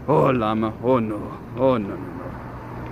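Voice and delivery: low, drawn-out imitation of a friend; mock impression. Showing off own impression of a friend, low mock voice. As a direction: drop it low and drag out the noes. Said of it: "That's mine".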